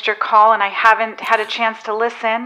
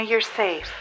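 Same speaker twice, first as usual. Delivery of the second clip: usual speaking voice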